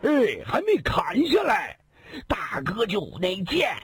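gleeful bandit. Acted. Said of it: "大笑着说道".

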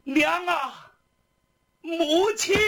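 high, loud wail to mother; tearful cry. A narrator's reading. Kneeling prisoner calls to his absent mother, tearful cry. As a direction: call out in anguish. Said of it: "那个眼泪吧嗒吧嗒吧嗒吧嗒下来".

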